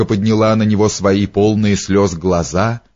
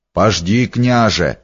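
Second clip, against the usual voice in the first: ordinary delivery, nothing marked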